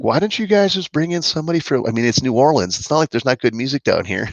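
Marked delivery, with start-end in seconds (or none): 0.0-1.8 lightly voiced higher mock local; a hint of a snooty local. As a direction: tease them in a mild put-on voice. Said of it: "they could have just been like".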